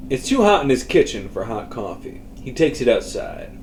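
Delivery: usual speaking voice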